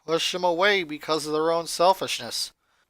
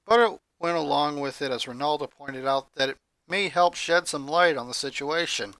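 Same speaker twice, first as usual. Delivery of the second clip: plain reading voice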